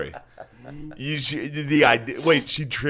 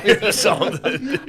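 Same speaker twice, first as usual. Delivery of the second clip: laughing, higher; 10 semitones higher, 4 dB louder than usual. Real, not acted. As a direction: squeeze it out mid-laugh. Laughing at friend.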